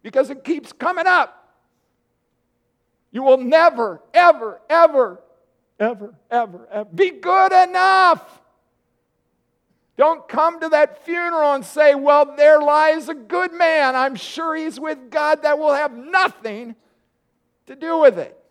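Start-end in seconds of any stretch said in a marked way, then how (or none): 3.1-8.4 high, loud, hammering each ever; preacher's shouted warning, with exclamation mark; emphatic shout. Real, not acted. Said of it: "stop with an exclamation mark". 10.0-18.4 high and loud, driving the point; fiery sermon warning; emphatic preaching. Real, not acted.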